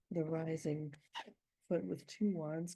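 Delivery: ordinary delivery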